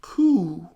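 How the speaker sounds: a small mock coo in the middle of the sentence; quoted pigeon coo. Person telling the professor about the pigeon, a small mock coo. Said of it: "that pigeon went up and went".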